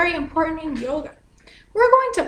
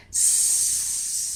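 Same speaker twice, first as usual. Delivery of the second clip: a long unvoiced hiss like a snake; 10 dB quieter than usual. Shown as a demonstration. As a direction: breathe out through the teeth, hissing. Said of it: "A sound like a snake".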